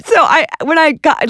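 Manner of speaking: ordinary talk, nothing marked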